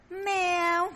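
high, cat-like mew of the word; woman mewing at her meal; meowing like a cat. Retold or imitated. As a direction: say it as a cat's meow. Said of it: "ăn meo meo như mà dòng mèo vậy".

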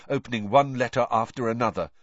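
usual speaking voice

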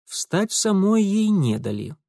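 usual speaking voice

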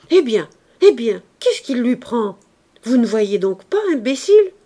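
angry shout. A narrator's reading. Concierge rushes at the mover.

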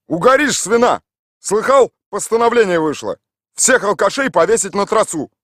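high, loud scolding; scolding woman. A narrator's reading. The woman at the fence scolds Mikhail Ivanovich loudly. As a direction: bawl out the drunk.

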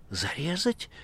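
high, startled question; alarmed rising question; frightened cry. A narrator's reading. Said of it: "испуганно вскрикнула Маргарита".

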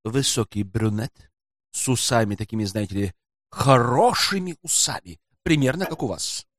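quiet, sly porter striking a deal. A narrator's reading. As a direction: confide it quietly, for a price. Porter's sly low-voiced dealings.

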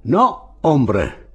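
high first word, then dropping in relief; relieved. A narrator's reading. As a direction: answer with relief after danger. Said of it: "disse André sollevato".

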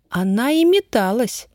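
high, emphatic confirmation; со знанием дела. A narrator's reading. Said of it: "сознанием дела сказала Даша". (со знанием дела, уверенно)

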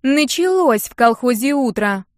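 a mocking Нинка drawling at her dizzy friend; drawn out. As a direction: stretch the words out mockingly. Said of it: "протянула Нинка".

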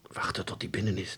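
soft whisper to himself; secret thought. A narrator's reading. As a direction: whisper it while lying in wait. Boy's secret thought in ambush. (zacht gefluisterde gedachte)

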